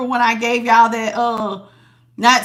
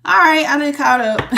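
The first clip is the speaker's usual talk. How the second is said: ordinary talk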